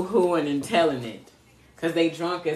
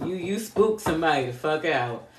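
ordinary talk

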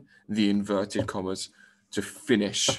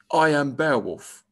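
loud, higher-pitched proud announcement; ringing hero's introduction; declaring; 5 semitones higher, 6 dB louder than usual. A narrator's reading. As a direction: announce yourself boldly. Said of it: "he declared".

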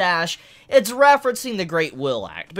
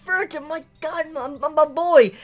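fond exasperation; 7 semitones higher than usual. Retold or imitated. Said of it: "I'm like".

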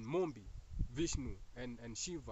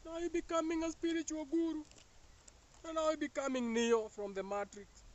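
high excited build-up; excited hype; 14 semitones higher, 3 dB louder than usual. Real, not acted. Speaker hyping listeners into gurus, high excited voice. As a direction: hype them up, voice soaring high.

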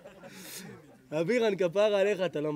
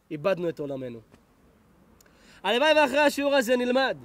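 raised, higher preaching voice; preaching blessing; 4 semitones higher, 5 dB louder than usual. Real, not acted. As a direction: bless the congregation with fervor. Prays blessing over the congregation.

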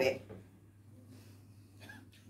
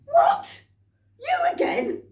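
much higher, louder disbelieving shout; outraged butcher; 7 dB louder, slower than usual. Acted. Butcher seeing the rabbit yet again, shouting in disbelief. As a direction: explode at the sight of him.

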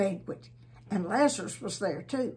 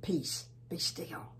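hushed command; 3 semitones lower, 5 dB quieter, slower than usual. Acted. Jesus calms the stormy wind.

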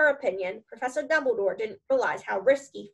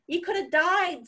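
much higher mock wail; sobbed; 8 semitones higher than usual. A narrator's reading. Gamekeeper distraught over the boy's near death, acted sob. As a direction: cry it out in distress, lightly acted. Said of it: "sobbed Hagrid".

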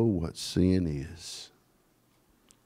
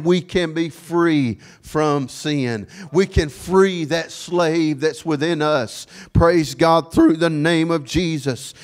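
forceful preaching; 4 semitones higher, 3 dB louder than usual. Real, not acted. Preacher urging the congregation to be free from sin, forceful preaching. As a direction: preach it out with stretched key words.

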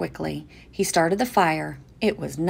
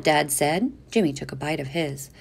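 ordinary delivery, nothing marked

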